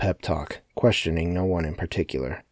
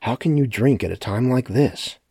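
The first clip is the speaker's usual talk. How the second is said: shocked, raised question; surprised; 6 semitones higher, 4 dB louder than usual. A narrator's reading. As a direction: ask it in disbelief. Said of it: "surprised by his forward actions".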